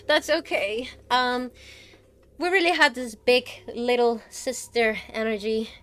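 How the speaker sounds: ordinary talk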